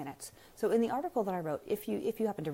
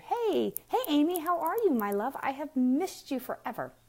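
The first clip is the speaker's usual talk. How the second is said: excited greeting; 8 semitones higher, 6 dB louder than usual. Real, not acted. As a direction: burst out welcoming a long-lost friend. Greeting a friend joining live.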